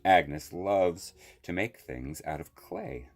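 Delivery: ordinary delivery, nothing marked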